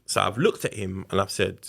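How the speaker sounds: ordinary talk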